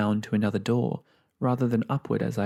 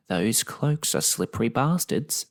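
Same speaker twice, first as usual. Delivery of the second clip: put-on old woman voice. Old gran grumbles.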